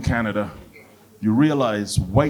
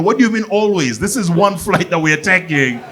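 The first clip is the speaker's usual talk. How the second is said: high, incredulous questioning; higher-pitched comic exasperation; indignant retort; 6 semitones higher than usual. Acted. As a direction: challenge it in mock disbelief.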